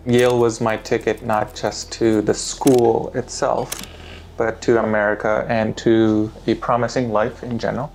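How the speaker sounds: ordinary delivery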